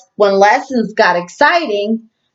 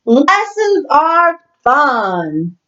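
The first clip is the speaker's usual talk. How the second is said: slow happy line; 5 semitones higher, much slower than usual. Acted.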